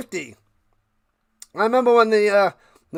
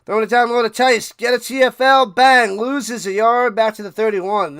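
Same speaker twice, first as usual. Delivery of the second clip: quick, louder announcer call; live play-by-play; 3 semitones higher, 6 dB louder than usual. Acted. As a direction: snap out the play like a broadcaster. Streamer calls the play live.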